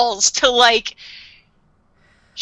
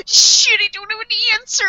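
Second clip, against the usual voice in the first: mock dismay; 9 semitones higher than usual. Imitating Light caught out.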